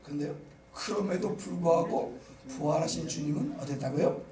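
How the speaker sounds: ordinary delivery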